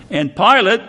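ordinary talk, nothing marked

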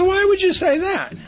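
high, loud shocked mom; startled mother. Retold or imitated. Mother startled by her son's question, high loud voice. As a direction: shoot it back like a startled mother. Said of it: "She goes".

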